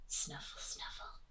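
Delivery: soft unpitched whispery snuffles; tiny snuffling animal voice; snuffling hedgehog. A narrator's reading. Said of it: "went the hedgehog".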